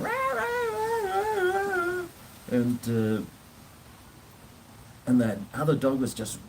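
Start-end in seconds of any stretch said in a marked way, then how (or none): 0.0-2.0 very high, slow yelping cries; imitating a hurt dog crying out. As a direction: squeal like a small dog in pain. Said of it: "Rollo was going".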